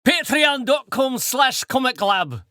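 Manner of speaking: high, slow, calling-out Scottish accent. Shown as a demonstration. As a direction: call it out in a drawn-out Scottish accent.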